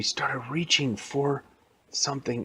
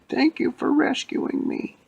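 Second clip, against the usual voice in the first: put-on slow, high-pitched old lady voice; 3 dB louder, slower than usual. Rescued old lady thanking the heroes, slow high-pitched voice. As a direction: speak slowly in a very high old lady's voice. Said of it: "there was the real old lady".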